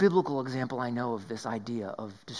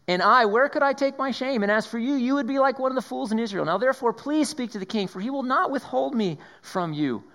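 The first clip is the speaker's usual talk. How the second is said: pleading Tamar; 9 semitones higher, 4 dB louder than usual. Acted. Tamar pleads with her brother.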